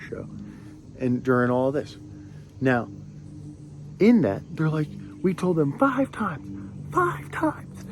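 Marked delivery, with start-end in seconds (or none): 5.2-7.8 high-pitched frantic imitation yell; mock screaming. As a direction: imitate someone screaming their head off, without real volume. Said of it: "screaming, absolutely losing their minds".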